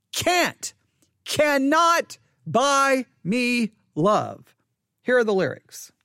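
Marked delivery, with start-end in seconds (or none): 0.1-4.6 can't high, then slow cannot buy me love; stress on cannot. Shown as a demonstration. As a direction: spell it out slowly, hitting cannot. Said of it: "Please note".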